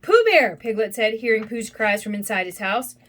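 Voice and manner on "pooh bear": higher, louder worried cry; storybook cry of alarm; alarmed call. A narrator's reading. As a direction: call out to a friend in trouble. Piglet hears Pooh's cries.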